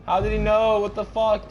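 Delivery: high, loud startled yelling; real shock, voice shooting up high; alarmed shout. Real, not acted. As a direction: yell it in panicked disbelief. Startled by chaser in horror game.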